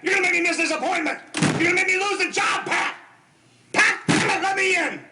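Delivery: bang to be let in; shouting through a door. Acted. Husband shouting at his wife through a locked door, angry yelling.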